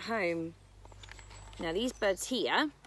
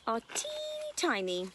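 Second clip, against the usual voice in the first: tiny voice; 9 semitones higher than usual. Pretend. Describing tiny birds.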